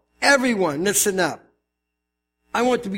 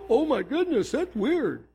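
mock shocked; 3 semitones higher, 4 dB quieter than usual. Plays scandalized heathens after gasp.

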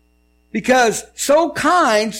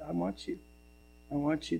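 hushed, low retold prayer; very quiet, subdued retelling of a surrender; broken plea; 6 semitones lower, 15 dB quieter than usual. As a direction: softly imitate a broken-hearted plea. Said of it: "she just broke and asked him".

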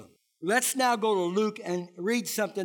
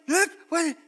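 raised, higher mock protest; exasperated protest; 8 semitones higher, 6 dB louder than usual. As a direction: throw up your hands in disbelief. Retelling trying to tell people.

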